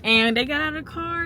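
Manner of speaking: ordinary talk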